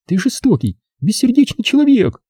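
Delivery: put-on high girlish accusation; accusing girl. Zaza accuses him.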